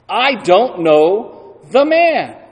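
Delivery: very slow "I don't know the man", "man" drawn out and falling; a slow, emphatic retold denial, "man" stressed; strongest denial. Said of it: "lashes out with his strongest denial".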